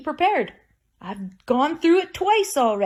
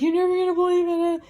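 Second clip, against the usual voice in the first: higher, fast, lightly acted telling; retold phone news; 7 semitones higher, faster than usual. Telling her aunt that Mom died, fast and high. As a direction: rush it out like breaking news. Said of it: "I was like".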